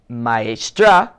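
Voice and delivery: Maestra with a clear open A at the end; model pronunciation. Shown as a demonstration. Teacher models Maestra for the students. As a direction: stress the final A of Maestra. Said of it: "now listen".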